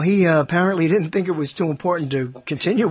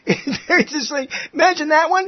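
ordinary talk

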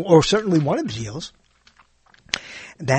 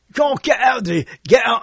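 badly faked Australian accent, pitched up; horrible Aussie accent; 9 semitones higher than usual. Shown as a demonstration. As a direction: attempt a broad Aussie accent and botch it. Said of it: "I'm gonna do a horrible Aussie accent now".

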